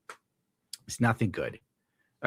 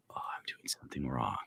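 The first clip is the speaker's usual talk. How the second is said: whisper; 11 semitones lower, 14 dB quieter than usual. Real, not acted. Fumbling with stream setup.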